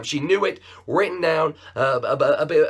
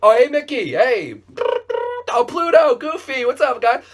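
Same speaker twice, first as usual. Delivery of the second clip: high, loud, bubbly hello; over-the-top cheery greeting; 10 semitones higher, 6 dB louder than usual. Acted. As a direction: greet everyone like a delighted theme-park fan, much higher and louder. Cheery fake greeting on phone.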